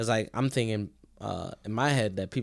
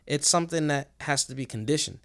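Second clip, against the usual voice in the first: usual speaking voice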